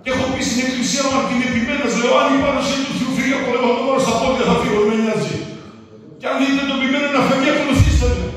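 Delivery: a preacher drives his point home to the church, forceful preaching. Real, not acted. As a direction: preach it to the congregation.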